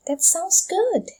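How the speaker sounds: "That sounds good" slow and pitched high, GOOD dropping at the very end. Shown as a demonstration. Teacher modelling an answer to an offer, "That sounds good" in high, slow intonation. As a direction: lift "That sounds good" way up, drawn out. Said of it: "dengan intonasi yang naik ke atas".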